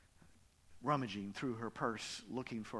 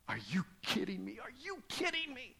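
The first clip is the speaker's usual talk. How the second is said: wailing; 10 semitones higher than usual. Retold or imitated. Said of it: "she was wailing".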